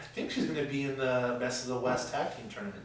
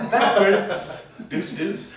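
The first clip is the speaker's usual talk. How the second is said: laughing; 7 semitones higher, 10 dB louder, much slower than usual. Real, not acted. Laughing at the flub.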